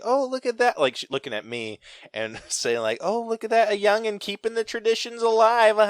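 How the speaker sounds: hammed-up approving old Southern lady; old Southern lady praising a young fan, high and sweet. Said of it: "she's just like".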